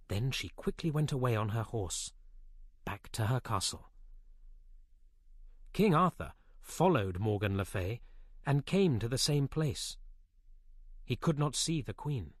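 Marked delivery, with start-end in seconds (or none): none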